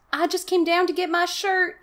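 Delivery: put-on a scared boy excusing himself; voice trembling. Casey explaining to his angry father, high nervous voice. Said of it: "his voice trembling".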